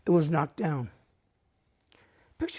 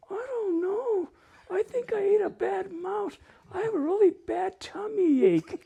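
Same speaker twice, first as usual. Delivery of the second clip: sister playing cat; 10 semitones higher than usual. Hidden sister answering as the cat, high and pitiful. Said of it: "Elma's going".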